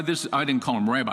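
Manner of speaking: usual speaking voice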